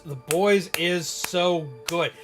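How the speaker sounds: ordinary delivery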